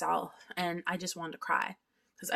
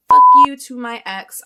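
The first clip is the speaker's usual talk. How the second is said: much louder, shouted curse; 7 semitones higher, 21 dB louder than usual. Real, not acted. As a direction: yell it at the ex. Cursing out her ex.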